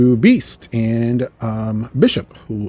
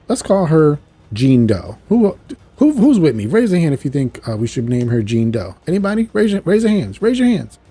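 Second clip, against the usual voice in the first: jokey, raised pitch calling for a show of hands; rally a crowd for a silly idea; playful, high and loud appeal; clowning around; 6 semitones higher, 6 dB louder than usual. Retold or imitated. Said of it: "he's always trying to be funny".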